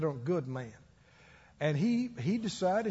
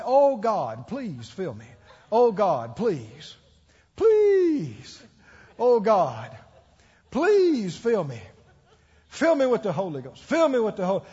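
loud, drawn-out plea to God; acted-out pleading; 6 dB louder, slower than usual. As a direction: beg loudly, stretching it out in mock desperation. Acting out his past altar prayer.

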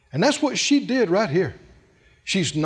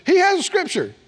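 imitating a wife's protest, higher-pitched; playful mock defense of a pet; Phyllis; 9 semitones higher, faster than usual. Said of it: "Phyllis says".

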